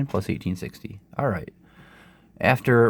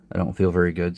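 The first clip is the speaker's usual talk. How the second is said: usual speaking voice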